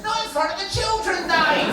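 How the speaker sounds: high, loud scolding; Nanny. Retold or imitated. Child imitates Nanny telling off Granddad, scolding voice. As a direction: cry out a shocked reprimand, high. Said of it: "can you do an impression of Nanny?".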